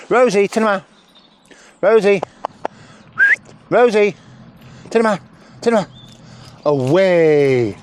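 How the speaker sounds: high, loud shout of the dog's name; calling out. Real, not acted. Trainer shouting the dog's name across the field.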